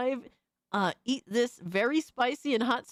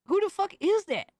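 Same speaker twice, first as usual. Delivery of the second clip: surprised outburst; 8 semitones higher than usual. Real, not acted. Streamer reacting to clip.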